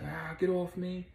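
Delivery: high, slow pleading cry; panicked struggle. Retold or imitated. Vicky thrown into a room, high pleading. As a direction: fight them off in a panic. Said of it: "So Vicky's like".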